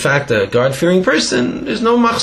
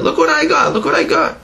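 much higher, gleeful boast; excited. Retold or imitated. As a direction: show off the prize, thrilled.

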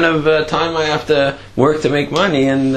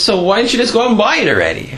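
puzzled challenge; 9 semitones higher, faster than usual. Acted. Student challenges rabbi in anecdote.

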